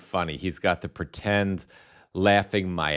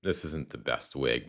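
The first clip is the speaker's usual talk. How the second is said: usual speaking voice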